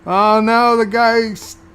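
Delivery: loud, high, slowed and stumbling; a real viewer's dismayed wail; shocked outcry. Real, not acted. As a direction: blurt it out in horror at the screen. Viewer reacts to the stabbing.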